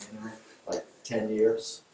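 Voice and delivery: usual speaking voice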